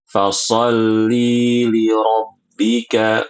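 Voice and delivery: fasolli li rabbika with the vowel wrongly held long; wrong lengthened vowel. Shown as a demonstration. Teacher contrasting the wrong long reading.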